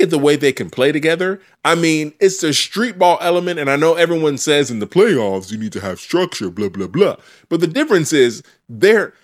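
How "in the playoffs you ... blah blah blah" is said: limp, half-hearted mock opinion; weak voice. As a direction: say it weakly, like you don't buy it. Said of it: "That's why I did that weak voice".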